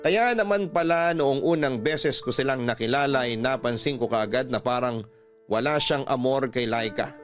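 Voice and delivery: ordinary talk, nothing marked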